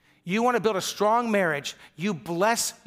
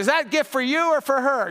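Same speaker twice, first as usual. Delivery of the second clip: higher, louder mocking question; teasing jab; 8 semitones higher, 6 dB louder than usual. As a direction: needle him with a grin. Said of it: "one of the guys goes".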